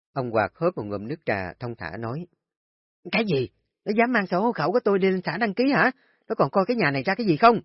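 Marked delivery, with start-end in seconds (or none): 3.1-7.6 high, loud and fast, outraged shriek; furious outburst. Acted. Wife to husband about their son, outraged shouting. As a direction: explode at the news like an outraged mother.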